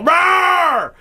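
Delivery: dinosaur voice. Retold or imitated. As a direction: roar it like a beast at the players. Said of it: "stand in front of the players and go".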